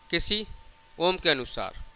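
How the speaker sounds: ordinary delivery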